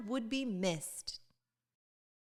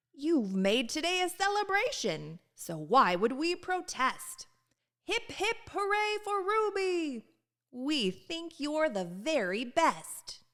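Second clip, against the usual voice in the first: higher, bright group cheer; cheering townsfolk; 6 semitones higher than usual. Acted. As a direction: cheer her on, hip hip hooray.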